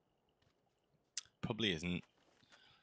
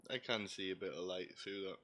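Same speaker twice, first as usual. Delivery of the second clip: breathy whispered aside, voice dropped right down; 12 dB quieter than usual. Real, not acted. Joking about his see-through ginger skin, in a whisper. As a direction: lean in and whisper it like a secret.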